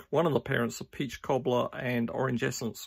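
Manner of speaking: ordinary delivery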